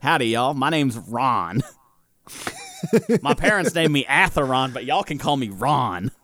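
hammed-up howdy cowboy greeting; folksy cowboy Ron. Player introducing his character to the table, light cowboy voice. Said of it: "I embraced the human side".

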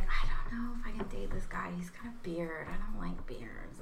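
hushed, breathy aside; whispering. Retold or imitated. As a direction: whisper it like a secret confession. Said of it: "I was like".

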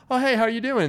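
high, stiff polite greeting; awkward stranger greeting. Retold or imitated. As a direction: say hi like it's a bit awkward. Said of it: "here it's like".

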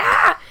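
sudden high yelp of fright; mock startled shriek at a spider; startled scream. Mock startled shriek at a bug in the house. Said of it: "the scream".

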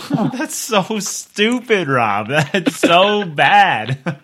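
loud, drawn-out, high-pitched laughing disbelief; laughing outrage. Real, not acted. Co-host reacting to Rob's stupid joke, laughing outrage. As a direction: howl it in amused protest.

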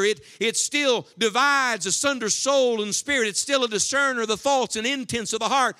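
loud, high, ringing pulpit preaching; fiery revival preacher, slowing on the big line; preaching at full force. Acted. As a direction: lift the church with every word, full voice.

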